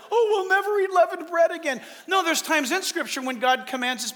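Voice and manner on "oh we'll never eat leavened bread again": high, put-on lament; overdone higher-pitched dismay; mock upset. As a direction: imitate someone getting all upset. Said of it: "don't get all upset like".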